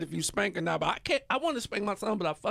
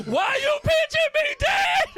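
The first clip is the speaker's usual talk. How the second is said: very high, loud laughing scream; screaming kid; 6 dB louder, slower than usual. Retold or imitated. Son yelling at dad in the supermarket, screaming. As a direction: shriek it like a kid in a store. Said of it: "started screaming loud".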